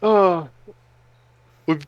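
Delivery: ordinary talk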